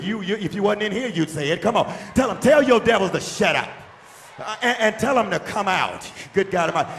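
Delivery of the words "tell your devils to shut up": louder, forceful telling-off; with attitude. Shown as a demonstration. Preacher showing the congregation how to tell devils off, with attitude. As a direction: say it like you mean it. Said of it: "Come on, get an attitude".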